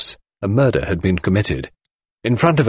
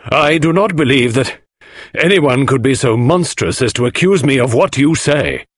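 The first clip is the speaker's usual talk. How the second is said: higher-pitched, emphatic protest; Inglethorpe, indignant; 6 semitones higher, 4 dB louder than usual. Acted. Accused husband answers Poirot's charge, indignantly. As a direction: refuse the charge in outraged disbelief.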